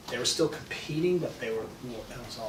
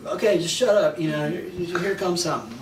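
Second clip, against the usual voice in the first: impatient friend; 6 semitones higher, 6 dB louder than usual. Retold or imitated. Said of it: "he goes".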